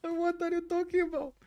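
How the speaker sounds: very high, loud "what are you talking about"; mock bewildered. Streamer repeating his baffled reaction to the Paris girls, very high. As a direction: squeak out the confusion. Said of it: "You know".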